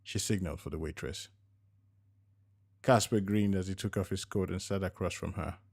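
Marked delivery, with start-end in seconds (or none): none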